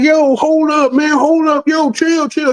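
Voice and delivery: high-pitched imitated pleading to stop; mock alarmed protest. Man with his young lady to backing driver, mock alarmed protest. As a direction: act out a man calling for a halt, pitch way up.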